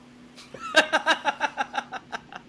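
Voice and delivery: long, high laugh; loud peals of high laughter; real laughter. Real, not acted. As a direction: crack up at a friend's joke. Laughing at the co-host's boast.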